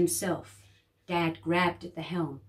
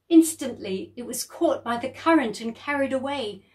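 ordinary delivery, nothing marked

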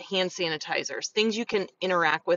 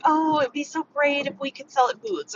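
higher-pitched mock wishing; imitated bummed wishing; 7 semitones higher than usual. Bummed sellers wishing they could sell at booths, imitated disappointment. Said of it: "people get bummed abo-, out by that".

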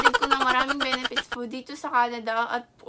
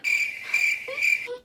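laugh; 9 semitones higher, 5 dB quieter than usual. Real, not acted. Laughs at own joke.

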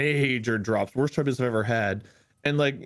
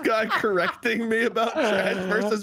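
higher indignant rant, breaking into laughter; retelling a friend's outrage at being corrected, laughing mock fury; mock exasperated rant; 7 semitones higher, slower than usual. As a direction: rant as if furious but cracking up. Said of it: "J P was so pissed".

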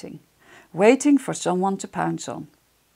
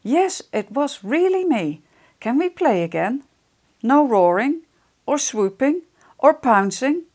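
small hopeful voice; 5 semitones higher than usual. Acted. Friends' hopeful asking.

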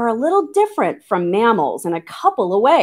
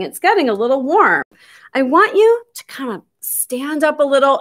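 ordinary talk, nothing marked

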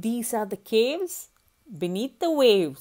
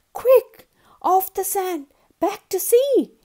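high, loud, frantic shout; urgent cry; 11 semitones higher, 9 dB louder than usual. Acted. Tiny snail urging the beached whale back to sea, panicked shout. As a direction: yell it as if the whale's life depends on it. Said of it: "cried the snail".

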